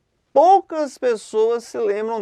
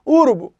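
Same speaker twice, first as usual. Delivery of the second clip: URUBU as Ú-ru-bu, opening vowel punched; proparoxytone stress; 4 semitones higher, 6 dB louder than usual. Shown as a demonstration. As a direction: shift the stress of "urubu" to the very first U. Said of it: "toda proparoxítona é acentuada". ("urubu" com a tônica no primeiro U)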